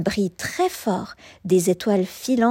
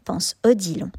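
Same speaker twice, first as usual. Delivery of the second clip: plain reading voice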